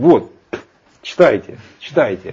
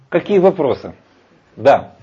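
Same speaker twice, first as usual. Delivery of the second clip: usual speaking voice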